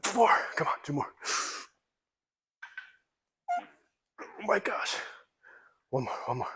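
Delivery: straining through the last reps; gritting out encouragement mid-lift; lifting weights. Real, not acted.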